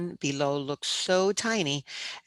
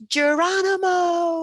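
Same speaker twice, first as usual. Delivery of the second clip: very high, loud, drawn-out yell; 7 dB louder, slower than usual. A narrator's reading. Boy jumps from the beam onto hay, long high yell. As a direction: stretch it into a long jumping war cry. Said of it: "So I yelled out".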